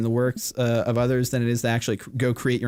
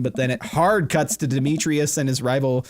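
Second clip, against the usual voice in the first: ordinary delivery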